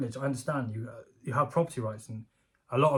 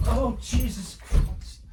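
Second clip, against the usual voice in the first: sudden pain; 10 semitones higher, 3 dB louder, much slower than usual. Real, not acted. Kicked his own shin.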